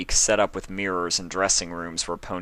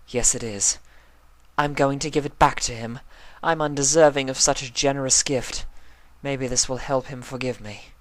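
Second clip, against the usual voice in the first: high, refined mare, contrite and resolved; lightly voiced Rarity; 7 semitones higher than usual. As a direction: confess you don't deserve the gift.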